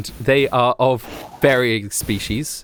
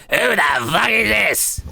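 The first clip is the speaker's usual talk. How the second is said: higher, slow, mock-gruff yell through a door; rude goblin shout; 7 semitones higher than usual. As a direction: bark it at the stranger at the door. GM voices creature behind door.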